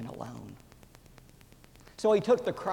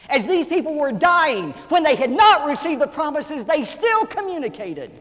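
building from strong to a ringing, much higher, much louder cry; preaching at full force; 9 semitones higher, 12 dB louder than usual. Acted. Preacher to congregation about dying patriarchs, building to a much louder, higher shout. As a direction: climb each line until the whole church rings.

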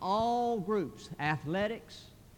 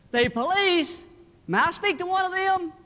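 high, loud phone voice; loud telemarketer; 12 semitones higher, 10 dB louder than usual. Acted. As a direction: quiz a child loudly down the line. Telemarketer calling a whispering child.

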